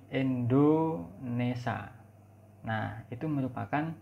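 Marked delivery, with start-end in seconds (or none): 0.1-1.9 "Indonesia" as "Endonesa", very slow; regional E pronunciation. Shown as a demonstration. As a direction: say "Indonesia" with E for the first I and a plain "sa" ending. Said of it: "Atau menggunakan E lagi". ("Indonesia" dilafalkan "Endonesa", pelan sekali)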